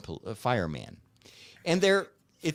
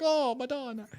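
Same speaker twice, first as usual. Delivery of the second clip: mock Italian lady; 10 semitones higher than usual. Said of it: "this Italian lady ... totally in distress".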